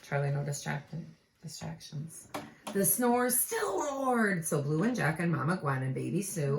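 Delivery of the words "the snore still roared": big slow storybook line; dramatic slow refrain. A narrator's reading. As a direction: stretch every word. Refrain stretched.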